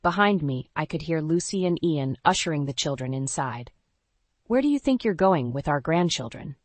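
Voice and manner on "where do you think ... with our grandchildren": usual speaking voice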